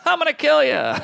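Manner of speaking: high, cheery mock threat; a sweet voice with a killer line. Bedwetter to his friend, high cheery mock threat.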